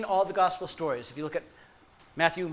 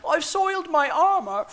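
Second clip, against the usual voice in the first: a lightly voiced, higher Monty Python soldier; 8 semitones higher than usual. Preacher voicing a terrified Roman soldier, high comic squeak. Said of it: "picture Monty Python and one of them going".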